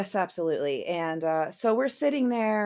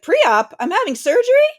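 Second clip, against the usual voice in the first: high, loud alarmed questions; shocked disbelief; 12 semitones higher, 12 dB louder than usual. Retold or imitated. She panics to the orderly, high alarmed disbelief. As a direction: panic rising as it sinks in.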